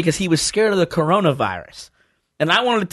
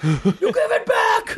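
slow, very high, laughing yell; high shouted cartoon quote; much slower than usual. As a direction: stretch it out in a squeaky shout. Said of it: "He's like".

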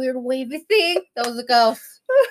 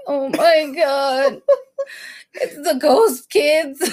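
slow, drawn-out, half-laughing wail; playful ghost-story panic; mock dread; 4 semitones higher, much slower than usual. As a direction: stretch it out in hammed-up fear. Mock dread on hearing ghost kids.

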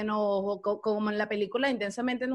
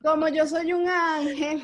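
mock saintly; 3 semitones higher, 5 dB louder than usual. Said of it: "Pobre yo".

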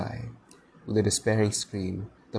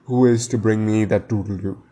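louder captain's demand; thundering; 5 dB louder than usual. A narrator's reading. Captain demanding a volunteer from his crew, thundering. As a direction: demand it forcefully. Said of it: "he thundered".

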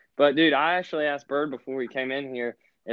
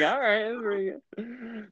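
mock approving voice; 8 semitones higher, 4 dB quieter, slower than usual. Friend voiced in story.

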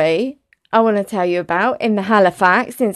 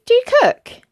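high-pitched imitated question; acted-out chirpy enquiry; stranger's question; 4 dB louder than usual. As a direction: ask it high, voicing someone else.

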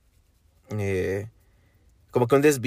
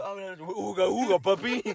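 high goofy caveman voice; 8 semitones higher, 3 dB quieter than usual. Pretend. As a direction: talk like a caveman. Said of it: "Es como".

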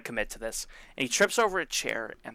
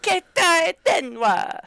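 much higher, louder, slow and drawn out, Keita Denwa; giddy gadget name; 7 dB louder, much slower than usual. Retold or imitated. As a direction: savour Keita Denwa like a shiny new toy. Mimicking Toka's hype over phone.

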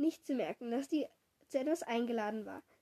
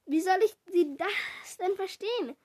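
gerufen; 5 semitones higher than usual. A narrator's reading. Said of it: "rief ich".

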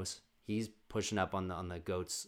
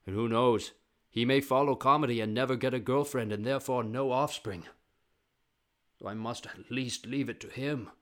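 mock-epic declaiming, starting loud; grandiose father; 3 semitones higher, 6 dB louder than usual. Father planning his baby son's legacy, grandiose. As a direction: declaim loudly, then ease off.

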